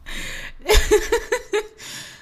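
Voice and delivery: high, loud fit of laughter; high cackling laugh. Real, not acted. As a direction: cackle helplessly at your own joke. Cracks up mocking the team.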